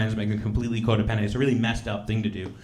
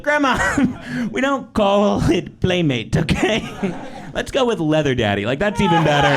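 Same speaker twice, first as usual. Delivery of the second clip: high, laughing mock scolding; laughing through brother's quote; 8 semitones higher, 4 dB louder than usual. Brother corrects grandma, laughing through it.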